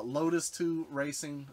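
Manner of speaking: ordinary talk, nothing marked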